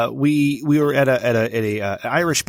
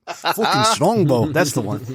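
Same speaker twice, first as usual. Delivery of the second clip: put-on drawn-out comic Irish accent; amateur Irish imitation, starting high; attempted Irish accent; 4 semitones higher, slower than usual. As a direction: stretch it out in a rough Irish accent, amused. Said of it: "I wish I could do an Irish accent".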